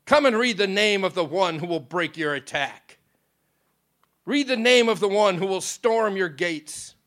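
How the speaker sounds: loud, ringing royal command. A narrator's reading. The king summoning Lucifer to read the book, loud command. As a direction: summon the deceiver with full authority. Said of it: "He turned it toward Lucifer and commanded".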